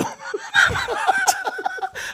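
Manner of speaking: high, helpless laughing fit; real laughter. Real, not acted. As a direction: crack up for a couple of seconds. Laughing at embarrassing story.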